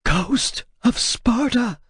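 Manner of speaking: high, slow, terrified gasp of recognition; frightened rasp. Acted. Translator recognizing Kratos, frightened rasp. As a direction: rasp it out in fear. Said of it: "rasped out in a frightened voice".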